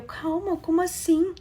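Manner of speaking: high, alarmed Calma, como assim; retold shocked bride; startled disbelief. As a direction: jump up in shock at his words. Wife shocked by husband's apology.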